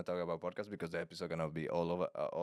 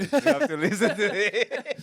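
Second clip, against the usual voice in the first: high, loud, drawn-out laughing voice; laughing apology; 12 semitones higher, 9 dB louder than usual. Acted. Podcaster replying to mean comments, laughing apology. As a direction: crack up through the words.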